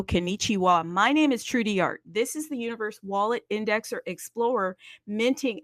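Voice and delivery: normal talking voice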